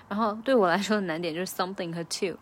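normal talking voice

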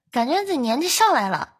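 normal talking voice

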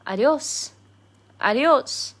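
formal goodbye. Shown as a demonstration. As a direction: sing out adiós formally. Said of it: "in a very formal way".

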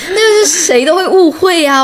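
high-pitched, incredulous protest; amused indignation, pitch jumping up; exasperated protest. Real, not acted. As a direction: burst out as if it's obvious. Exasperated protest after a laugh.

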